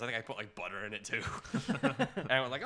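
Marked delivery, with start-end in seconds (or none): none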